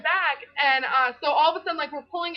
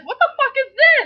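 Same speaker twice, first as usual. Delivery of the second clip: mock shocked yell; 11 semitones higher, 7 dB louder than usual. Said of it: "he's like".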